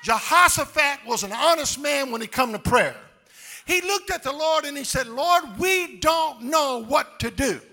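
loud, rising, chanted preaching; full-force preaching. Acted. Preacher rallying the congregation, loud chanted preaching. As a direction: build them up and shout it home.

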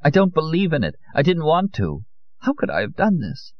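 high, upset woman's voice; high-voiced bewildered self-reproach; distressed disbelief. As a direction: protest in dismay, pitched well up. Amparo distraught after her trance.

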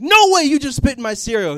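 high, loud outraged yell; little brother yelling at big brother in outrage; brother freaking out. Retold or imitated. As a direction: shout it like a kid who just saw you spit in his food. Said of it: "He's like".

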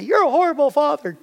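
a hint of a scolding mother at the pool; mock scolding wife. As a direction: toss off the wife's reproach lightly. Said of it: "His mother keeps yelling at me".

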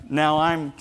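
ordinary delivery

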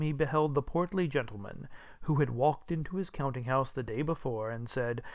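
ordinary delivery

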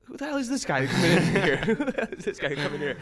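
high, loud, exasperated, laughing; mock outrage at the intruder; indignant inner thought. Host recalling annoyed first thought about the newcomer, loud and laughing. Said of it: "My first thought was".

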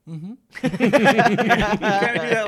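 high, slow laughing words. Real, not acted. Friends recalling tipping, laughing. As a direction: laugh through it.

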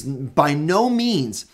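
By no means, very slow, each word pressed, no hit hardest; By no means, slowly stressing no as the strongest denial; emphatic negation. Acted. Preacher repeats Paul's answer to the congregation, emphatic slow denial. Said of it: "an emphatic, strongest negation possible".

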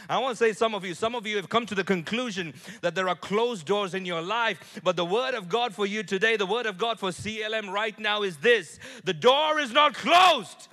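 high, loud, building to a shout; climactic preaching shout. Acted. A pastor proclaiming to the congregation, building shout. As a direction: declare it over the whole church.

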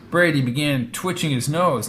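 usual speaking voice

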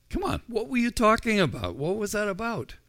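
high, quick, playful prodding; teasing Jesus. Acted. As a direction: coax them like a teasing friend. Jesus teasingly presses the disciples.

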